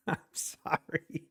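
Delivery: soft, breathy through laughter; laughing apology. Real, not acted. Trader laughing at a viewer's loss, apologizing. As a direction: apologise while still giggling. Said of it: "I should not laugh".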